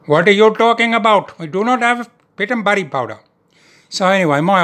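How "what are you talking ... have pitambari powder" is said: put-on high, loud indignant Indian shopkeeper accent; the shocked Indian grocer turning him down. Indian grocer refusing him pitambari powder, indignant Indian accent. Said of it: "I asked for this".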